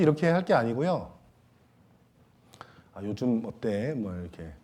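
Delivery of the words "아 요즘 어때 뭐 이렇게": gentle check-in. Shown as a demonstration. Pastor showing how to ask a struggling member, gentle and soft. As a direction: ask softly after a friend's struggles. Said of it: "이렇게 얘기도 좀 해주고".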